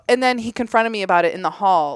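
ordinary talk, nothing marked

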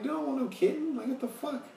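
very high-pitched mock disbelief; incredulous refusal. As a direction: squeak out a baffled refusal. Acted incredulous refusal of a kitten.

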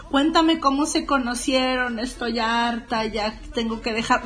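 ordinary talk

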